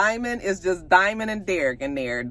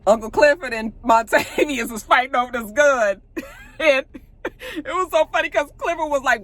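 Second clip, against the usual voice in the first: laughing while telling the story, pitched up; real amused laughter; 5 semitones higher, 3 dB louder than usual. Real, not acted. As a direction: try to narrate through your laughter. Laughs while retelling funny fight.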